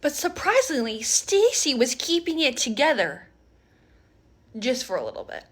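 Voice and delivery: normal talking voice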